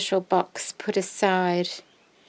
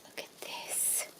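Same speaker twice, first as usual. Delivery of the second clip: whisper=40; 11 dB quieter, much slower than usual. Real, not acted. Whispering in awe.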